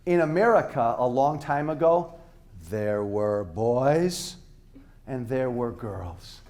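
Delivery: put-on slow, deep, drawn-out words as if to a child; speaking really slowly. Shown as a demonstration. Speaking slowly to the audience as if they're kids. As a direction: spell it out slowly for the crowd. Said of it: "I'm gonna speak really slowly".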